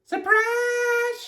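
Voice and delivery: very high, drawn-out squeal; Amelia Bedelia's shout. A narrator's reading. Amelia Bedelia greets the guest, shouting high. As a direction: stretch it out way up high, no extra volume. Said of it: "shouted Amelia Bedelia".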